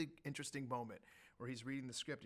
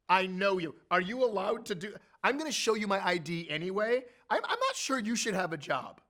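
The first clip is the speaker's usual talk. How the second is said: loud, higher teasing scold; louder, higher mock outrage; mock indignation; 6 semitones higher, 11 dB louder than usual. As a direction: rib the guard loudly. Mocking the guard.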